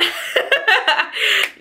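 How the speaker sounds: a high, breathy laugh through tears; teary high laugh. Real, not acted. Holding back tears on camera, a high laugh.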